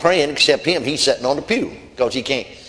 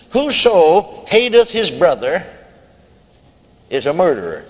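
scripture reading; 2 semitones higher, 3 dB louder, slower than usual. Acted.

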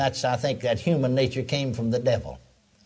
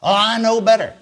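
louder, higher mock blow-up; angry outburst; 6 semitones higher, 6 dB louder than usual. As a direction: snap back like a furious preacher. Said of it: "he just blew up".

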